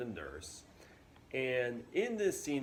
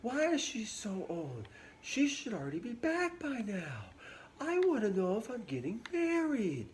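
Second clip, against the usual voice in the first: impatient Juliet; 12 semitones higher than usual. Retold or imitated. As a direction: whine like a bored impatient girl. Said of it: "she's just kinda complaining about the nurse".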